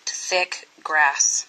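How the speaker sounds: usual speaking voice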